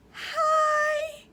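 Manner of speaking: very high, loud joyful hi; a small child thrilled to see you; delighted hi. Retold or imitated. Little girl greeting the teacher in the hall, delighted. Kindergartner greets speaker happily.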